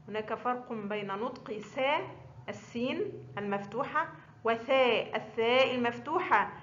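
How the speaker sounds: normal talking voice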